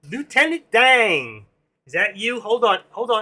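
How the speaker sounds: mild Forrest Gump impression greeting a viewer. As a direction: stretch out Lieutenant Dang like Forrest Gump. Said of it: "Bubba Gump, there you go".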